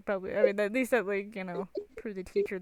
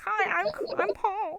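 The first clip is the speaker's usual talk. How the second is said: high, slow put-on character voice; Paul; 11 semitones higher, slower than usual. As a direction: imitate a character introducing himself, pitched way up. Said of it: "You know what that reminds me of?".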